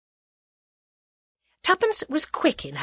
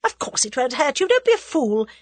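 brusque, higher-pitched dismissal; exasperated reassurance, rising louder; impatient; 6 semitones higher, 4 dB louder than usual. A narrator's reading. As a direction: brush off a silly fear impatiently. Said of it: "said the other impatiently".

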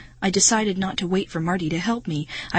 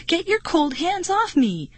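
sharp angry snap, much higher; snapping; 11 semitones higher than usual. A narrator's reading. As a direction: snap at him in disgust. Same angry outburst at Marty.

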